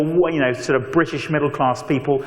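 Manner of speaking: ordinary talk, nothing marked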